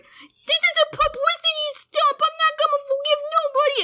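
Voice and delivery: high mock whiny brat; mock tantrum voice, high; bratty teen. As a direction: voice a sulky kid yelling. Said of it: "he's like".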